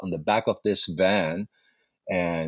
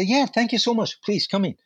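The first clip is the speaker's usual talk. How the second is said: higher, bright with delight at each new fare; thrilled, eager welcome; 10 semitones higher than usual. Retold or imitated. New cab driver welcomes passengers, high and thrilled. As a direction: gush thanks to every passenger climbing in. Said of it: "I was so excited".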